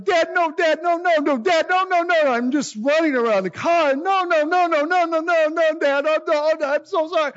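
high, loud, frantic string of no's; panicked pleading. Retold or imitated. Son begging dad as he sees the damaged car, frantic. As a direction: beg dad not to look, in full panic.

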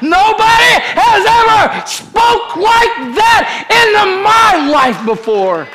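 put-on high, slow, shouted child's cry of wonder; a boy who can't believe it; excited little boy. Little boy off Jesus' lap, high excited shout. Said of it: "little boy jumps off".

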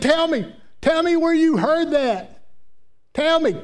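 imitated upset mother, insistent and high-pitched; demanding mama. Mama demanding where her little girl heard it, high insistence. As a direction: press the child for an answer as a worked-up mama.

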